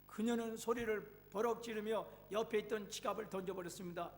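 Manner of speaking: usual speaking voice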